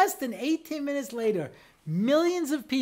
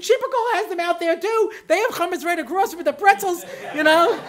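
raised, higher voice in an argument; exasperated husband pleading his case, louder and higher; heated protest; 7 semitones higher, 4 dB louder than usual. Retold or imitated. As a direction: insist loudly that it's only a closed bottle. Arguing with wife over wine.

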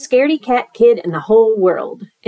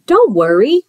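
reassuring character; 4 semitones higher, 4 dB louder than usual. Acted. Monster reassures the other kid.

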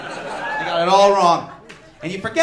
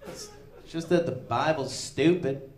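smug atheist; 9 semitones lower, 6 dB quieter, slower than usual. Retold or imitated. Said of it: "where he goes".

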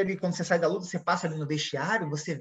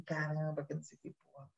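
muttered much softer; soft, lower grumbling curse to himself; under the breath; 4 semitones lower, 14 dB quieter than usual. Retold or imitated. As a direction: mutter the curse almost inaudibly. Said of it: "Aí eu falei assim".

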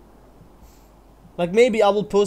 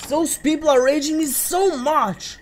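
exasperated; 9 semitones higher, 4 dB louder than usual. Real, not acted. Venting anger.

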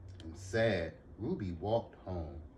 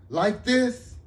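loud; 12 dB louder than usual. Acted. Ruby answers ask to be quieter.

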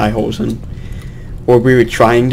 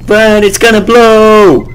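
alarmed shout; 10 semitones higher, 8 dB louder, slower than usual. Real, not acted. Bomb about to explode.

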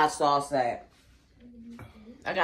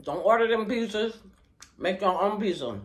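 talking between bites; chewing while talking; 5 semitones higher, faster than usual. Real, not acted. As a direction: mouth partly full of food. Seen 0: Holds pizza slice, lips pursed and moving as if chewing between bites.

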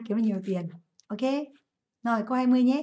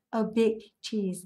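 slow, clear English model phrase; slower than usual. Shown as a demonstration. Teacher slowly models the idiom for students. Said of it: "So we say".

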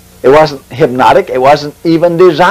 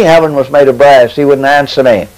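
usual speaking voice

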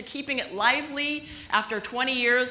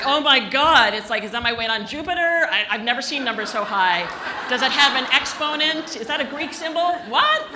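mock shocked disbelief; 3 semitones higher, 7 dB louder than usual. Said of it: "Just like".

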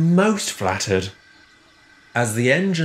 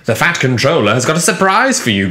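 quick, higher, louder delivery; grinning; 4 semitones higher, 6 dB louder, faster than usual. A narrator's reading. Duck tells Richard of a surprise, grinning. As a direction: say it through a grin. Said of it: "grinned Duck".

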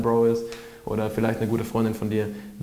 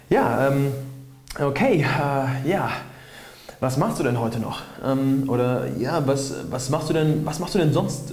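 halting, unsure small talk with filler pauses; searching for questions; 2 semitones higher than usual. Shown as a demonstration. Trying to get to know a stranger, awkwardly searching. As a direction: stumble and restart while hunting for something to ask. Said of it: "dass du dir in dem Moment denkst".

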